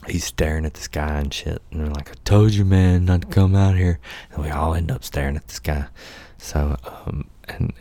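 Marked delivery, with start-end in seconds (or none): 2.1-4.0 raised, louder scolding; half-shouted I told you. Retold or imitated. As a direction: call it out, exasperated. Friends scolding him outside.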